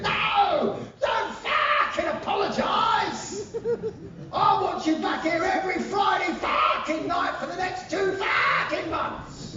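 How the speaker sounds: high bellowing Cockney hard-man shout, swearing with glee; comedy Cockney villain. Retold or imitated. Pub villain raving to the band after the gig, high Cockney shout. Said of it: "some comedy Cockney East End villain".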